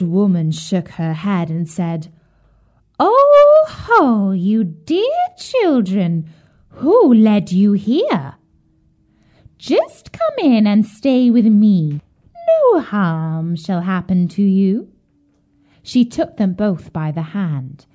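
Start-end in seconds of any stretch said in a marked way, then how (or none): 3.0-14.9 put-on luring old witch. A narrator's reading. Old woman invites lost children into her house, slow, luring witch. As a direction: coax them in with drawn-out, swooping words.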